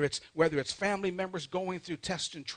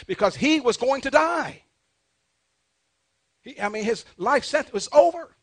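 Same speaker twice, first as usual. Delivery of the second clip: raised, loud preacher's exclamation; emphatic preaching; 8 semitones higher, 9 dB louder than usual. Real, not acted. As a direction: drive it home high and loud. Preacher stresses the king's death sentence.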